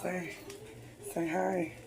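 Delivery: soft whispered coaxing; whispering. Real, not acted. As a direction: whisper it gently. Coaxing someone shy to greet.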